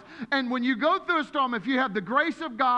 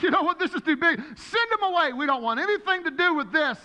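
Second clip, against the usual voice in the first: exasperated disciples; 7 semitones higher, 4 dB louder than usual. Retold or imitated. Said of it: "they're like".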